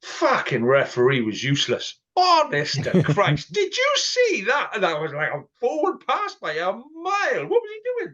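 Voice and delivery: high, loud hammed-up coach rant, rising into a squeal; mock furious rant. Imagined coach ranting at the referee after a loss, hammed-up fury. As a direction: rage at the referee like a coach losing it. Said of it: "Can you imagine him coming out".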